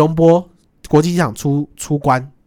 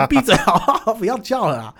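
laughing protest; 7 semitones higher than usual. Retold or imitated. Laughingly replays scolding a shouter.

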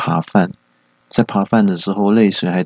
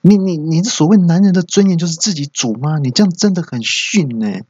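angry son; 9 semitones higher than usual. Retold or imitated. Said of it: "很生气".

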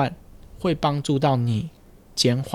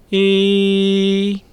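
"一" lifted higher and louder, placed forward; high resonance demo; 6 semitones higher, 4 dB louder than usual. Shown as a demonstration. Speech therapist contrasting a higher placement. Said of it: "都有不同的一个点的位置嘛".

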